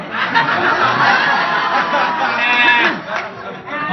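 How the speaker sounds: loud sustained laughter; hearty real laughter; long laugh. Real, not acted. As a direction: let the laugh roll on.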